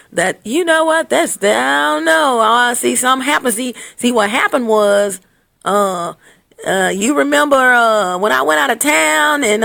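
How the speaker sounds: high, hesitant stumbling confession; put-on sheepish stalling; mock guilty excuse-making. Voicing cheater confessing by phone.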